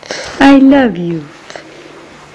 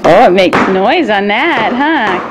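normal talking voice

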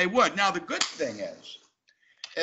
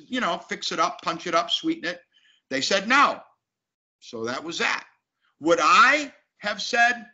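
usual speaking voice

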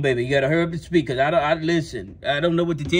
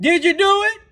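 loud, very high demand; yelling; 12 semitones higher, 6 dB louder than usual. Acted. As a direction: yell the question, pitch way up. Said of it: "can you stop yelling at me?".

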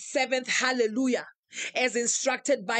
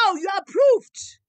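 loud, high ecstatic cry; 8 semitones higher, 9 dB louder than usual. Acted. Preacher crying out approval to a member, ecstatic loud cry. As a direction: cry it out in rapture.

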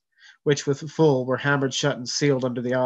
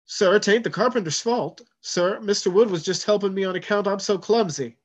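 put-on higher, pleading boy's voice; squealing plea; 7 semitones higher, 3 dB louder than usual. Cabin boy pleads with the first mate, squealing. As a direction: squeal it out to save your friend. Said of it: "Pip squealed".

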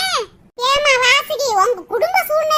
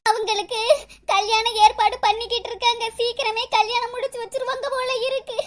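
soft, tearful explanation; acted crying; 2 semitones lower, 5 dB quieter than usual. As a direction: whimper the news, ending in sobs. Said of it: "எதுக்குடி அழுகுற". (விம்மலோடு மெல்லச் சொல்லுதல்)

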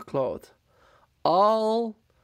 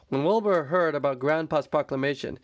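plain reading voice

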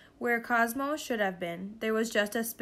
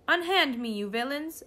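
high, indignant machine; mechanical computer voice; 5 semitones higher, 4 dB louder than usual. A narrator's reading. Backpack computer scolds its captors, mechanical voice. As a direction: protest grandly, starting high. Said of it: "said the voice inside the backpack".

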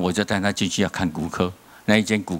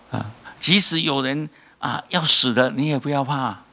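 preach; 4 semitones higher than usual. Acted. Preaching at full force.

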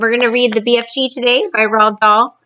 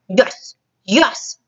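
mildly booming giant; lightly voiced bullying giant; 2 semitones higher than usual. A narrator's reading. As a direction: boom it with relish. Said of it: "boomed the Blood Bottler".

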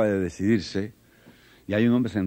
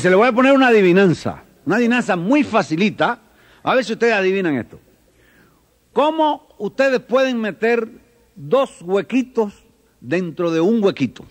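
put-on teacher to children; 10 semitones higher, 6 dB louder than usual. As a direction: spell out a riddle for small children. Teacher posing riddle to children.

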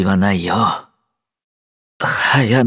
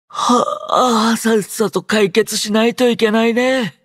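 high, stretched yawning voice; sleepy yawn carried into speech; talking through a yawn; 11 semitones higher than usual. Acted. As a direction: yawn wide, then keep talking high. Sleepy character readies for action.

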